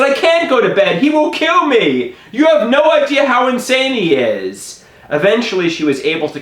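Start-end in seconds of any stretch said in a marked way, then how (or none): 0.0-4.8 high-pitched panicked protest; acted-out frantic refusal; hysterical. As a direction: plead in a frenzy, voice pitched way up. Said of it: "she thought I was acting hysterical".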